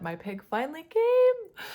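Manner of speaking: high, loud burst of joy; excited. Real, not acted. Vlogger announcing her childhood pig arrived, thrilled and loud. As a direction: squeal it out, thrilled it finally arrived.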